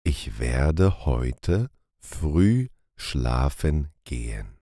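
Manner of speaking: ordinary delivery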